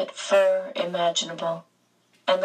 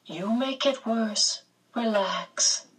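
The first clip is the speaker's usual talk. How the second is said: soothing beast; 4 semitones higher, slower than usual. A narrator's reading. Beast calming the pained girl, slow and soothing. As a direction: ease her down, slowly.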